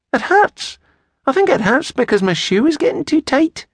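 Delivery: put-on high-pitched little girl's voice. A narrator's reading. Little girl tells the man her shoe hurts, high child's voice.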